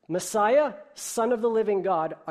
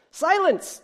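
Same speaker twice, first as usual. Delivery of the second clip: sharp shouted Silence, much higher and louder; barked command; 14 semitones higher, 10 dB louder than usual. Acted. King Yertle silencing Mac beneath him, barked shout. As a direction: bark it down at the turtle. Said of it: "barked back".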